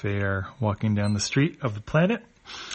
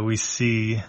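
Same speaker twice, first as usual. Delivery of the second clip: usual speaking voice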